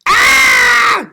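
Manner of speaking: very high loud scream of fright. Acted. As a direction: shriek in shock. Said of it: "yelled Granny".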